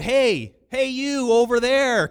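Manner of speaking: high, loud hail; calling out. Acted. Diner calls a waiter across restaurant, loud hail. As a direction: holler across a room for service. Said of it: "call out".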